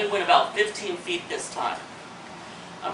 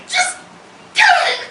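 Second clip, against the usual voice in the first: high, loud frantic scream; panicked yell; 6 dB louder than usual. Acted. Swimmer flailing as the snake approaches, panicked screaming. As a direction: shriek it out in helpless panic.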